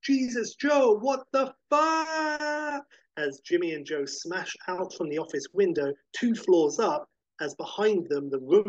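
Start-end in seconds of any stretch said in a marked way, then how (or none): 0.0-2.9 high-pitched yell, last word drawn out; panicked yell. A narrator's reading. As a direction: yell it high while being rushed out a window. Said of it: "and he yells".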